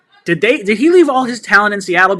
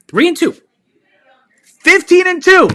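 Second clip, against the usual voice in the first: excited outburst; 13 semitones higher, 7 dB louder than usual. Real, not acted. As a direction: blurt out a wild prediction in real joy. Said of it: "don't get me too excited".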